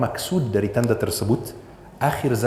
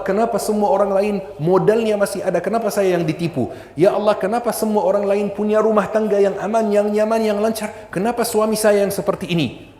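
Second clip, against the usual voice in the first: complaining believer; 8 semitones higher than usual. Retold or imitated. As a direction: act out a desperate wail of why me. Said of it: "dia teriak dan mengatakan". (ratapan tinggi dan keras, meniru orang yang mengeluh)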